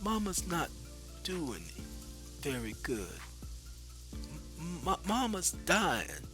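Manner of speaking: soft, tender, tearful; a dying mother breaking the news gently. Acted. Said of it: "she said with tears in her eyes".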